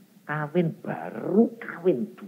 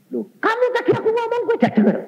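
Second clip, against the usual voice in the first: high, fast indignant scolding, much higher pitch; angry father; 6 dB louder, faster than usual. Retold or imitated. Preacher voices his father scolding him for not listening, high and angry. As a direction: snap at him like an offended father.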